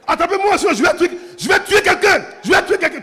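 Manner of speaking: high, loud hammed-up rage; enraged husband shouting he'll kill someone; mock furious shout. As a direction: yell it like a man losing control. Enraged man threatens to kill.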